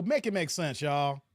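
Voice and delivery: usual speaking voice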